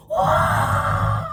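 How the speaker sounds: long, breath-heavy cry of awe; acted shout. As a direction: let out a big drawn-out wow. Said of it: "소리를". (크게 길게 내지르는 탄성)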